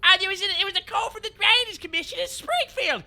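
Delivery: loud, high mock-Australian bellow; goofy, over-the-top Australian shouting; Australian accent. Quoting the Simpsons episode, loud Australian accent. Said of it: "goof on a gaff about an Australian accent".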